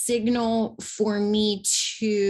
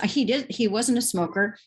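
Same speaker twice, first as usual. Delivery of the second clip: normal talking voice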